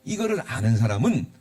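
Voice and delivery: ordinary talk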